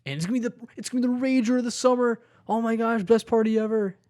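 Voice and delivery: hyped-up party bro, fast and loud; mock party bro. Friend mocking frat party hype, loud and fast. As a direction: ham up the excited frat guy.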